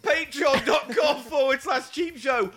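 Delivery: frantic plea. Pretend. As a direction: beg like it's the end. Frantic mock-desperate outro.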